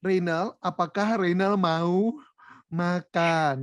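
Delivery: imitating slow, over-formal standard Indonesian; hammed-up formal asking, very slow; mock stiff formality. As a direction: draw out each word like a careful textbook question. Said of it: "jadi baku semua".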